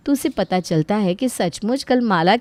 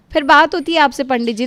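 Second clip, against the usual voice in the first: higher, louder staged sign-off; loud fake phone call; 5 semitones higher, 5 dB louder than usual. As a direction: end the pretend call for eavesdroppers to hear. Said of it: "ज़ोर-ज़ोर से बातें करने लगती है". (दिखावे की ज़ोरदार phone वाली आवाज़)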